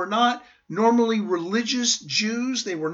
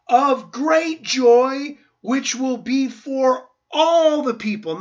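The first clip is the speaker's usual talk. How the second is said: louder, higher, slow and ringing; joyful proclamation; 4 semitones higher, 6 dB louder, slower than usual. Acted. Angel announcing good news to shepherds, ringing and loud. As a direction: proclaim "great joy" like a herald.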